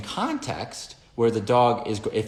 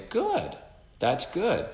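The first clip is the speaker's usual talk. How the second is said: higher, bright dog-praise voice; praising the dog; 9 semitones higher, much slower than usual. Retold or imitated. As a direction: praise the dog for sitting, pitched up. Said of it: "And I would say".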